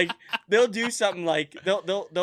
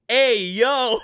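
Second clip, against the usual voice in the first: loud, higher-pitched imitation of a toddler; toddler's catchphrase yell; 6 semitones higher, 9 dB louder than usual. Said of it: "And Steven goes".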